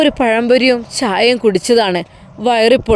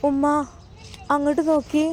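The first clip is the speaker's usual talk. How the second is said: hushed, timid call; 3 semitones higher, 8 dB quieter than usual. Acted. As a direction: softly call Mother, a little afraid. Frightened girl calls mother's attention. (പേടിയോടെ പതുക്കെ)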